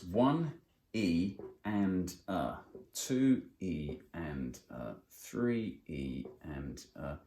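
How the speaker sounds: one E and a, said slowly beat by beat; slow spelled-out count. Shown as a demonstration. Teacher slowly spells out semiquaver counting. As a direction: break the count into syllables, pausing. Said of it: "We want to count like this".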